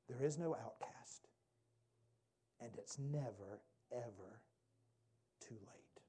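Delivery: drops to a near whisper, slow; hushed whisper. Real, not acted. Preacher to the crowd, hushed whisper. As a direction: let the words fall to a hushed whisper.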